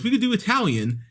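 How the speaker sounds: high-pitched imitation of another woman; put-on high female voice; mock woman's voice. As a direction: lift the pitch high for her suggestion. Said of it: "The other woman goes".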